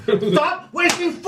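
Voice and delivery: angry yell. Real, not acted. Scolding over thrown fries.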